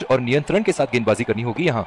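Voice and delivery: usual speaking voice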